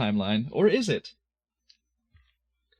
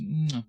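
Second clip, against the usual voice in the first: kiss sound. Acted. Kiss on tendril.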